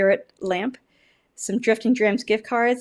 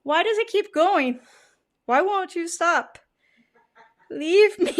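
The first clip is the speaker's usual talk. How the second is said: mock plea; 7 semitones higher than usual. Pleading with the whisky's burn.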